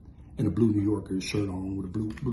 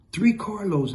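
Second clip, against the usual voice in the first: ordinary delivery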